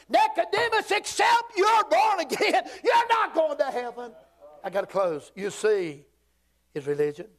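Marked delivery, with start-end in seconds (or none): 0.1-4.1 high, drawn-out pulpit shout; preacher's shouted warning. Acted. Preacher to Nicodemus on new birth, shouted warning. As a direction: cry it out at the top of your range, easing at the end.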